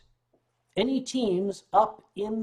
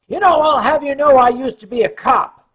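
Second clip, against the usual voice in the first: higher, loud blustering cop warning; looming threat; 8 semitones higher, 13 dB louder than usual. Retold or imitated. Security chief standing over Renee, loud and blustering. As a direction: loom over him and boast loudly.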